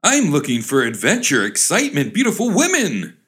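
high, climbing mock-eager cry. Young man leaving home to his father, crying out. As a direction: build to a high peak on the last words. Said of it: "cried the young man to his father".

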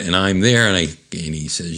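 ordinary delivery

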